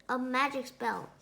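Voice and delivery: ordinary delivery, nothing marked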